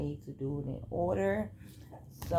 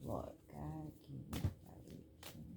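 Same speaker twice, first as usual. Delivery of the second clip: whispered prayer aside; 11 dB quieter than usual. Real, not acted.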